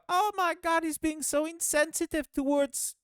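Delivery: high-pitched, mock-outraged whine; triggered complainer. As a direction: parody someone getting offended over nothing. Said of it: "everyone gets triggered about the smallest little things".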